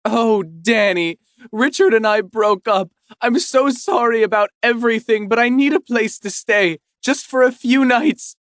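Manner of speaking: high, loud, tearful pleading ex-wife; tearful plea. Acted. Ex-wife in tears begging to stay, high tearful plea. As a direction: beg him through tears. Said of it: "she bursts into tears".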